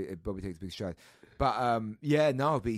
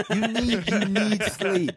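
mock insistence; 9 semitones higher, 9 dB louder, slower than usual. Telling shopkeeper to sleep.

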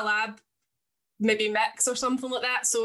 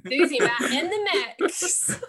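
hammed-up, drawn-out radio DJ announcement; 4 semitones higher, 3 dB louder than usual. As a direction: play a cheesy DJ, half laughing. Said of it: "why I never made it in radio".

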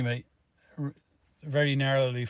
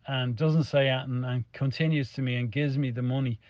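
normal talking voice

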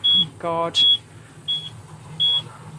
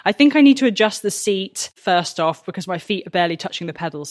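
normal talking voice

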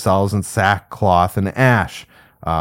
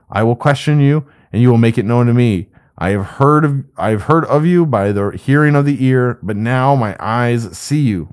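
higher reading voice for Job's humble reply; scripture reading; 3 semitones higher than usual. Acted. Teacher reading Job's reply to God, raised scripture reading. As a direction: read Job's answer aloud to the class.

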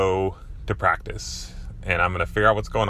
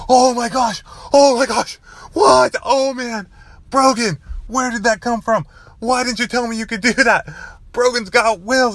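very high, loud, excited coach impression; coach freaking out; 6 dB louder than usual. Coach amazed by Brogan's sudden touchdown run, freaking out. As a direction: imitate a coach losing it with amazement. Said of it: "he starts freaking out".

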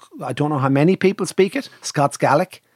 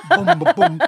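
high, loud booms through a laugh; laughing mock drum booms; laughing drum imitation; 14 semitones higher, 4 dB louder than usual.

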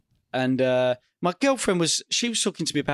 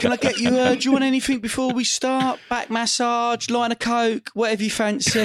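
mock waiter; 7 semitones higher than usual. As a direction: offer like a fawning butler. Mock host offering guest luxuries.

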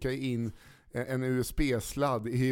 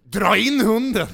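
loud, high, drawn-out yell; acted shout; 9 semitones higher, 12 dB louder, slower than usual. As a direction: yell it out in mock alarm. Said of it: "hur hade du tacklat den situationen". (låtsat utrop, högt och utdraget)

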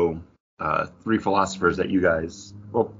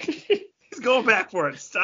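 exasperated at cat; 12 semitones higher, slower than usual. Real, not acted. Cat stealing jerky.

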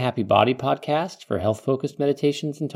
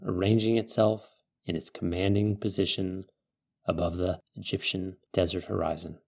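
calm meditative tone; 3 semitones lower than usual. Acted. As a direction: ease the listener into calm. Leads a guided desert meditation.

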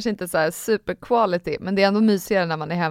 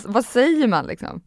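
ordinary delivery

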